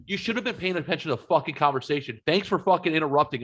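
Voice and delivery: ordinary talk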